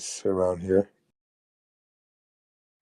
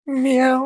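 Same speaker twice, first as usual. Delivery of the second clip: cat meow; 6 dB louder than usual. Acted. Joking cat call in chat.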